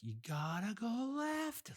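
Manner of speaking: high, slow, drawn-out mock volunteer; mock insistent. Old volunteer in a vest directing drivers, mock insistent. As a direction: stretch the order out in a high put-on voice. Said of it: "more old people in vests directing you".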